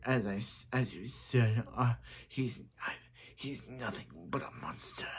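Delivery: hushed villain, sinking into a whisper; soft, whispery villain voice; Zoom voice. Acted. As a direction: slide down into a menacing whisper. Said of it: "I do a very good Zoom voice".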